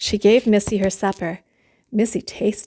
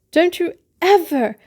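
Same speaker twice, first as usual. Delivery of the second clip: scolding mother; 9 semitones higher, 4 dB louder than usual. Acted. Missus Lane starts scolding.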